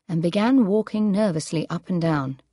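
usual speaking voice

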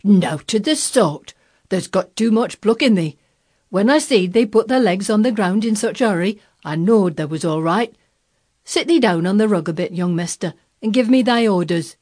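put-on loud, gruffly fond old Yorkshire gardener; crabbed tenderness. A narrator's reading. Old gardener settling the young master, crabbed but tender. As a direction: scold him fondly in broad Yorkshire. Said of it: "queer mixture of crabbed tenderness and shrewd understanding".

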